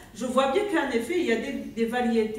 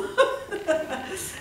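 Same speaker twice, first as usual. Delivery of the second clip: real laugh, pitched up; amused laugh at herself; high bright laugh; 7 semitones higher than usual. Real, not acted. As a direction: let a genuine laugh escape upward. Laughs about her accent.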